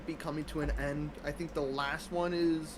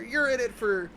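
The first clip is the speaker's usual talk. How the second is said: ordinary delivery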